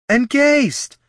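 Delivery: high, loud happy greeting; excited call. Acted. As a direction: call out his name, delighted. Greeting friends, calling out.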